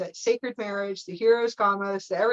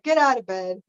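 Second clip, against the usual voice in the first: mock command; 8 dB louder than usual. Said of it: "I'm like".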